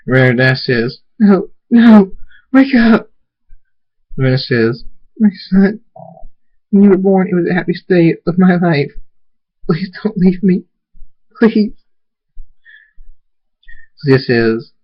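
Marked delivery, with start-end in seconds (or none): 1.2-3.1 high, loud frantic cries; panicked pleading; desperate denial. Acted. Refuses to accept his death. 5.2-11.7 high, distressed motherly voice; anguished, raised begging; grieving plea. Acted. Mother pleads over dying son.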